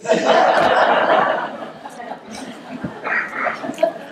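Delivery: long breathy laugh at the punchline; real laugh. Real, not acted. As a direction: laugh at your own story.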